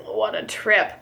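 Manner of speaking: ordinary delivery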